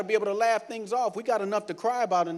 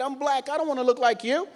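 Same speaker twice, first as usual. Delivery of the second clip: usual speaking voice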